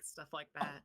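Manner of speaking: normal talking voice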